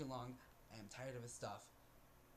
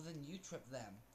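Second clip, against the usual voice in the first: usual speaking voice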